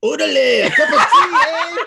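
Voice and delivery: loud, high hammed-up British accent. Shown as a demonstration. Reluctantly showing off a British accent on request, hammed-up and loud. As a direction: laugh through a shouted mock British accent. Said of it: "That's my British accent".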